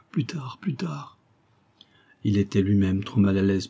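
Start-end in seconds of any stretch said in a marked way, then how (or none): 0.1-1.2 slow pleading whisper, much softer; ashamed plea sinking into a whisper; fading to a whisper. Acted. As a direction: beg him away under your breath. Ashamed woman begging him to leave.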